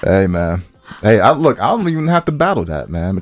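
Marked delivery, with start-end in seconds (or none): none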